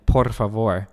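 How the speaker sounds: por favor, trilling por straight into favor; R rolled into F. Shown as a demonstration. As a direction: roll only the R of por into the F. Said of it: "roll straight into the F".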